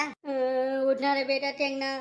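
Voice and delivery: high keening mother; acted high-pitched sobbing lament; wailing. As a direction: wail over a son who won't wake. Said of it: "टोटा फाड़ के का रोवत है".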